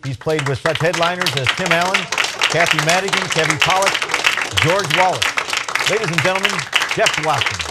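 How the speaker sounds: big, high announcer voice; announcer intro. Acted. Host introducing the comedian in an announcer voice. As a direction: sell the next act to the crowd.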